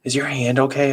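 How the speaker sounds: put-on high, timid little boy; small child voice. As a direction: ask like a sleepy young boy. Child voice in story.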